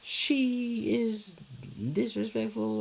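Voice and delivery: mom's mock complaint, high and quick; imitating mom. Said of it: "her, like".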